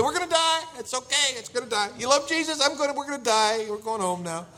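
very high, loud, frantic passenger; hammed-up panic. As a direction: panic wildly as if the plane is dropping. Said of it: "you begin to panic".

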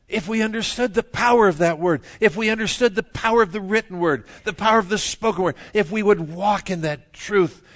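loud, high, driving sermon delivery; fervent preaching. Acted. Preacher urging congregation on the power of the Word, fervent. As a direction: build the repeated phrases with full pulpit force.

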